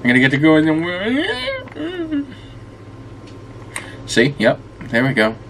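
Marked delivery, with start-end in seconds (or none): 0.0-2.3 pitched way up, half crying; whiny high voice. Pretend. Gamer whining up high at the game. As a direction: whine it like a kid being denied.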